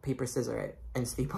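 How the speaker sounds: usual speaking voice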